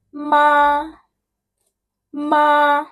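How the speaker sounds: MA held high and level; first tone. Shown as a demonstration. Teacher showing the first tone, straight and flat "ma". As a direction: say "ma" high and straight, no rise or fall. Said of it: "it's so straight, flat".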